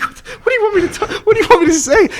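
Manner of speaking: high, laughing panic; panicked laughing. Retold or imitated. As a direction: squeak it out through laughter. Panicked at screaming possessed woman.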